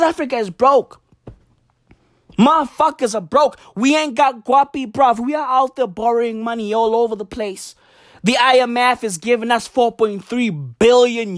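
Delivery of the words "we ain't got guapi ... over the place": ordinary talk, nothing marked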